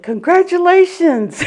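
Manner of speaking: high, loud, slow, laughing congratulations; mock cheerful congratulations. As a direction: beam it out like good news, half laughing. Gaffe greeting a newcomer.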